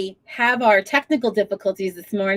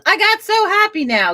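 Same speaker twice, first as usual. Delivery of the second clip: high, bubbly imitation of a happy viewer; imitating Isabella; 10 semitones higher than usual. Host reading a viewer's comment aloud in a high excited voice. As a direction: read the line back pitched up and delighted. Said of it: "Isabella goes".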